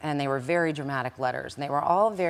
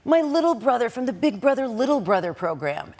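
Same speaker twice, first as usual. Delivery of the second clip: higher, slightly louder mock boyfriend voice; imitating the boyfriend; 9 semitones higher, 5 dB louder than usual. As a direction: parody how he smugly explained it. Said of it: "this is exactly how he talked".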